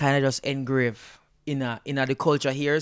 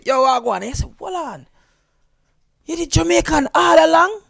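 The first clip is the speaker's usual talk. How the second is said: high-pitched mock astonishment; surprised discovery; 13 semitones higher, 6 dB louder than usual. Finding out the American is Jamaican, acted surprise. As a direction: act out a surprised double-take, voice jumping up.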